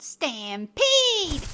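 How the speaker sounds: loud, high, stretched yell; cowboys' yell. A narrator's reading. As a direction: holler it across the range. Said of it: "The cowboys yelled".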